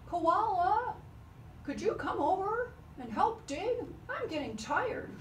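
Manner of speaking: high, carrying call across the field. Acted. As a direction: call out to a far-off friend. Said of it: "Kangaroo called out".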